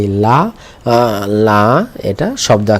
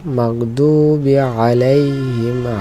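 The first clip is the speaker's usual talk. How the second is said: very slow Quranic reading, vowels held long; slow recitation; much slower than usual. Shown as a demonstration. Teacher reads the verse to find lam, reciting slowly. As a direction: stretch the long syllables evenly.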